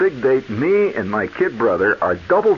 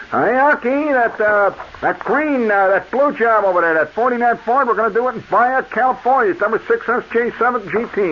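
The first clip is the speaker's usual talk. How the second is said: calling out; 10 semitones higher than usual. Retold or imitated. Salesman calling the paint order out to Aki. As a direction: sing out the order to the back.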